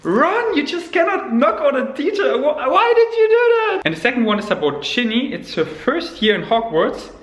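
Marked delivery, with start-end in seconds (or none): 0.0-3.8 high-pitched, laughing outrage; squeaky comic indignation with a laugh in it; mock scolding. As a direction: playfully tell off a friend, voice shooting way up.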